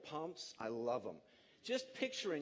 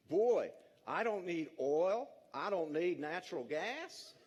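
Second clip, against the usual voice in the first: slow, louder folksy Midwestern farmer drawl; Midwestern farmer accent; 4 dB louder, slower than usual. Retold or imitated. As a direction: play a proud Midwestern farmer, slow and homespun. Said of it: "forgive the accent".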